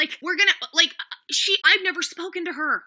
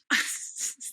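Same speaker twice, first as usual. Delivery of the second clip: breathless scoff; 8 dB quieter than usual. Real, not acted. Bitter laugh at the situation.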